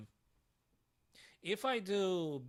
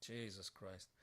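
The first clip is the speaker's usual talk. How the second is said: much softer, lower defeated mutter; muttered under breath; 7 semitones lower, 10 dB quieter than usual. Real, not acted. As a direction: say it to yourself, crushed. Mutters after his blunder.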